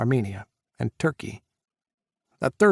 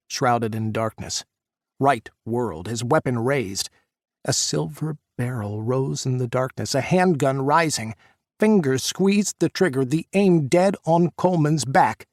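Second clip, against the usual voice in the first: ordinary delivery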